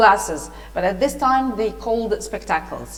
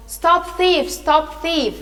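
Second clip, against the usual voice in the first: high, raised cry of alarm; shouted alarm; 6 semitones higher, 4 dB louder, slower than usual. A narrator's reading. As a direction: call out to stop a fleeing thief. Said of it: "started to shout".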